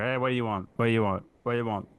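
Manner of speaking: imitating a curt, fed-up waiter; mildly irritated order-taking, acted out; retold pissed-off server. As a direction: run the repeated question together impatiently. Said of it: "pissed off taking our orders and stuff".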